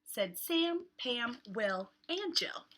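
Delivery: lively picture-book read with voiced characters; reading to children. Teacher reading a beach book aloud to kids, lively. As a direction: read it like story time for little ones.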